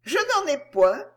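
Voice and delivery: high, confident, smiling answer; reply with calm assurance; assured smile. A narrator's reading. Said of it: "avec un sourire assuré".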